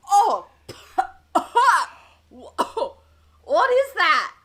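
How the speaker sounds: loud, high shocked cry after a swig; shocked exclamation. Acted. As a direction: react to burning liquor, loud and high. Drunk swigs strong alcohol.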